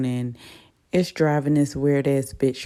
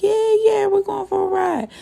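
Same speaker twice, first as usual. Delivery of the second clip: put-on much higher, thrilled kid voices; excited kids. Kids thrilled their dad is taking them out, much higher and excited. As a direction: chime in like little kids who just heard great news.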